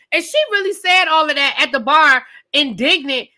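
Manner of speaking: ordinary talk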